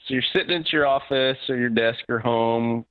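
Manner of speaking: ordinary talk, nothing marked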